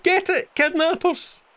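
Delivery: very high, cartoonish thug proudly correcting; silly villain voice. Goon correcting the villager about kidnapping, in a high cartoon voice. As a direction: squeak it out like a proud dim crook.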